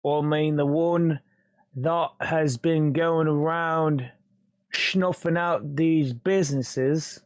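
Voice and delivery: slow, deliberate British gangster. Acted. Intruder explains who the Shark is, slow British gangster accent. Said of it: "sounding like a British gangster".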